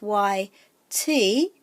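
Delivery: "Y T" with the T drawn out; slow, lean long on the T in "Y T"; stress on T. Shown as a demonstration. Teacher showing where the macron falls, stress on T in "Y T". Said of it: "the T is the part that we're focusing on".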